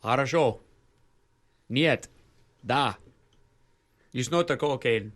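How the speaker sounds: hammed-up Russian accent with Russian words; a lightly done cartoon Russian; mock Russian fan talking about football; bad Russian accent. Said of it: "The Russian accent is my favorite, the bad accent".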